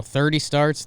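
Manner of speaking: normal talking voice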